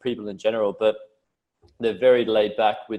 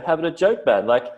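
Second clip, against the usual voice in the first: higher, relaxed teasing mate; lightly voiced easygoing Aussie; 7 semitones higher than usual. Aussie telling Americans to relax, light teasing voice. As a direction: laugh it off, don't be serious. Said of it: "And I'm like".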